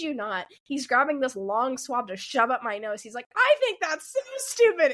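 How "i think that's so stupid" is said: high-pitched, exaggerated nurse's outburst; mock indignant rant. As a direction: scoff in a put-on high voice. Acting out the nurse's indignant tangent.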